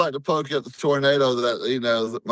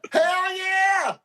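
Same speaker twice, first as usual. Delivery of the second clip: a big, high whoop of joy; triumphant shout. Retold or imitated. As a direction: yell it like your team just came back. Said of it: "and I went".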